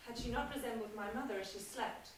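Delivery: low, soft, slowing to a whisper; hushed confession. Acted. Wife confessing why she couldn't kill, hushed to a whisper. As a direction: confess it under your breath.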